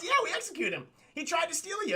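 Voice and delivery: high-pitched, laughing mock defence; defensive justification. Jafar explains the execution to princess, comic defensiveness. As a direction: blurt the excuse, voice pushed up.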